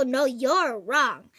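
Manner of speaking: ordinary talk, nothing marked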